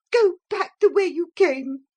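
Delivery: high loud furious order; angry cry. A narrator's reading. Motor lady to the hippogriff, angry cry. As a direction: force it out in rage. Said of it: "she cried".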